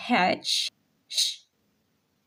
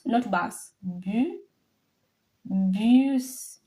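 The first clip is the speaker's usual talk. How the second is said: normal talking voice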